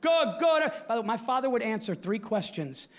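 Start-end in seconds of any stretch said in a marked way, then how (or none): 0.0-0.7 put-on high, hearty old Italian dad; dad on the phone. Bronx father calling his son, hearty high greeting. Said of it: "he'd go".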